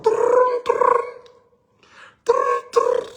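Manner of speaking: high rolled "trrrn" like a ringing phone; imitated phone ring. Storyteller mimics friend's call ringing in the car, high trilled ring.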